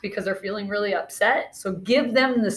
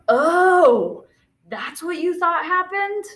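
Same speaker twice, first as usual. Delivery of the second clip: high "Oh" then a higher-pitched question; acted realization; 7 semitones higher, slower than usual. Student realizing what upset the friend. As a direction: realize it with mock surprise.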